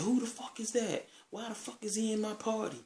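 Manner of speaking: very high-pitched imitated outrage; mock flipping out. Party host finds strangers in his chat, flipping out. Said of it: "flipped out and said".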